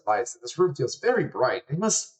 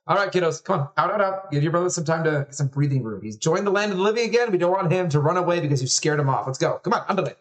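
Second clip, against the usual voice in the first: put-on higher-pitched mother rounding up the kids; bustling mom; 5 semitones higher than usual. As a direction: shoo the children out, brisk and firm. Mother herds children out.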